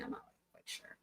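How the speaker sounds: usual speaking voice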